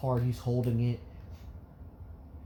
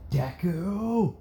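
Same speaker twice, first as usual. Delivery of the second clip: raised, drawn-out mocking call; taunting bully; 4 semitones higher than usual. Kacchan mocking Izuku, taunting call. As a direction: stretch the nickname into a taunt.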